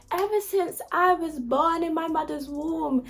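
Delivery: high, sudden Jamaican accent, played for laughs; mock Jamaican accent. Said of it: "that Jamaican accent just came out of nowhere".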